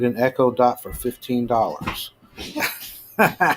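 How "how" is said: laughing. Real, not acted. Amused at cheap Echo Dot ad.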